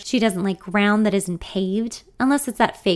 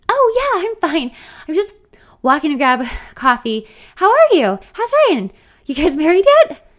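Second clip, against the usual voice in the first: overly bright; 9 semitones higher, 9 dB louder than usual. Acted. Friend brushing off, overly bright.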